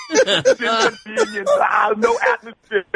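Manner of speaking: strained high voice, breaking into laughter; Schwarzenegger impression. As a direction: Arnold straining, cracking up. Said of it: "He's like".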